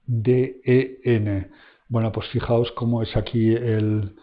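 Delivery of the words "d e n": each letter said very slowly and separately; slow letter-by-letter spelling. Shown as a demonstration. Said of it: "o sea, era den".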